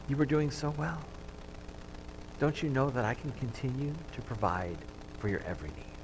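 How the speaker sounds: softly spoken rebuke of Jesus; gentle and hushed, no yelling; quiet. Acted. Jesus to Peter in the sinking boat, soft and hushed. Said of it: "very quietly".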